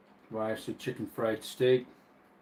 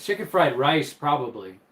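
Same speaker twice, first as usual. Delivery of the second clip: usual speaking voice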